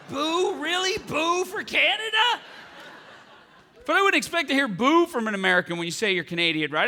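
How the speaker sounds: ordinary delivery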